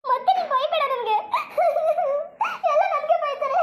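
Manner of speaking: high wailing plea broken by sobs; crying. Acted. Heartbroken woman begging her friend not to scold, crying. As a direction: cry out your hurt between sobs. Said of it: "ಕಣ್ಣೀರು ಒರೆಸು ನೀನು".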